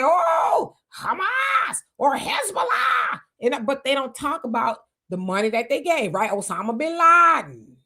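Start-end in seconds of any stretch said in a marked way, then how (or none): 0.0-3.2 hammed-up mock shout, drawn out; mock alarm. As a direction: parody the officials crying the names. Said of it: "and then say".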